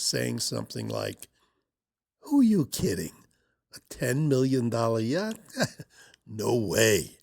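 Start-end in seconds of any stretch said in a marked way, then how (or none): 2.2-7.2 lightly acted doubting voice, with a small scornful laugh; mock disbelief, as if scoffing at a wild idea; scoffing inner voice. Subconscious answering a ten million dollar goal, lightly scoffing. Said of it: "there's a little voice in your subconscious".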